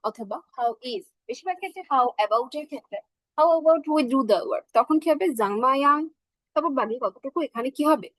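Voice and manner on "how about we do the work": normal talking voice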